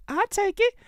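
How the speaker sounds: tiny falsetto squeak; very high put-on little voice; mocking squeak. Host mimicking a caller accepting, mock squeak. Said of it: "Pretty Mimi that said, "I take it".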